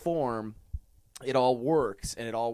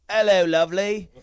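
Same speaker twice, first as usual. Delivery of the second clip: high, loud British greeting; hammed-up British hello; mock British accent; 7 semitones higher, 8 dB louder than usual. Host teasing the British guest, mock British accent.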